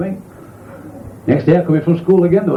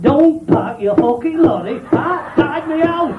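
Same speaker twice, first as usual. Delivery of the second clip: high, slow, put-on woman's voice; the woman scolding the lorry driver, high and slow; indignant woman; 10 semitones higher, slower than usual. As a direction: drag out the punchline, scandalised. Punchline in woman's voice.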